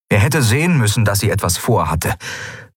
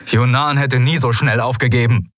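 higher-pitched, bitter reproach; reproachful; 6 semitones higher than usual. A narrator's reading. Kai reproaching his mentor's copy, bitter. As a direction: throw the reproach at him, pitch raised.

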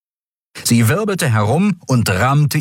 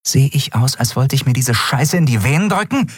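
mild angry snap; hissing; 5 semitones higher than usual. A narrator's reading. Endriel rebuffing the dealer, a touch of hiss. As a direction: spit the question back with contempt. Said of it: "fauchte sie".

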